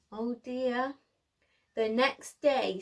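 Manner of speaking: plain reading voice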